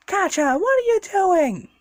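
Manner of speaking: put-on high, strained young hero voice; Deku's high voice. Deku yelling at his friend in alarm, high. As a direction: pitch way up, urgent and alarmed. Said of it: "He's like".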